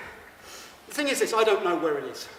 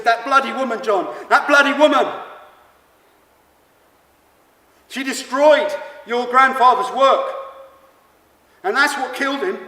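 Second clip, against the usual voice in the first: grandma's voice, louder, bitter and furious; angry grandma; 7 semitones higher, 9 dB louder than usual. Retold or imitated. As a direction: rage at that woman while banging the table. Grandma bangs fist on table.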